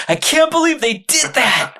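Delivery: high, loud, delighted exclamation; thrilled disbelief. Shown as a demonstration. Host showing how inflection changes the meaning, thrilled. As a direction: squeal it like the best news ever. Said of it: "different than when I said".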